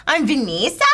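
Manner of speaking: imitated New Zealand "Vanessa", middle vowel as ee; New Zealand accent. New Zealand friend gives her name, New Zealand accent. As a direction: introduce yourself as a New Zealander. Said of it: "and she'd go".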